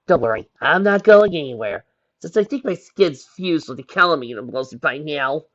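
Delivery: ordinary delivery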